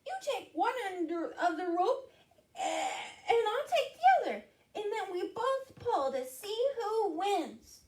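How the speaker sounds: high, excited-sounding mouse chatter; high little mouse voice; squeaky mouse. Acted. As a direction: explain it in a tiny high squeak. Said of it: "squeaked Mouse".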